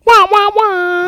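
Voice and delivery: high, slow, loud sad-trombone wah; mock wah wah, drawn out; mocking blah blah. Sad trombone at the episode's end.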